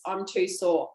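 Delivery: ordinary talk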